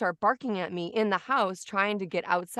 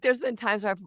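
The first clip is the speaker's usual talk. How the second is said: normal talking voice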